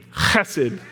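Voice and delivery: chesed with a throat-clearing ch; guttural chesed. Shown as a demonstration. Pastor leading the congregation in saying it, guttural. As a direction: rasp the ch in chesed. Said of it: "say it with me".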